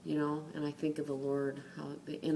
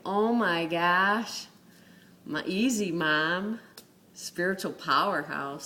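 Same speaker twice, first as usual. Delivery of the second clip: mock amazement; 6 dB louder than usual. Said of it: "I'm like".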